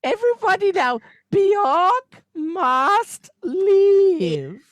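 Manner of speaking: loud, high, very slow chant; hammed-up singer impression, dragged right out. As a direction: lead the crowd in a high, stretched-out chant. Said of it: "You sound like Mario now".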